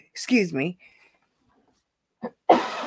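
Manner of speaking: throat still irritated after coughing; swallowed wrong way. Real, not acted. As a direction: talk through the tickle in the throat. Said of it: "Coffee went down the wrong pipe".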